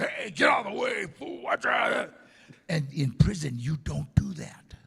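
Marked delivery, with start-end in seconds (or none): 0.0-2.1 high shouted convict yelling; cussing. Retold or imitated. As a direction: bark it like a rough inmate on set. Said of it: "just cussing".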